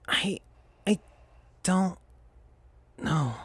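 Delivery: a weak, broken reply; crackly voice. Acted. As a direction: barely get the words out. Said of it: "with a crackly sounding voice".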